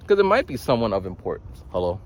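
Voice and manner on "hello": flat, uninviting phone hello. Shown as a demonstration. Answering a random call that might matter, flat. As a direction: answer the phone without any enthusiasm. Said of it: "it's just a kind of flat hello".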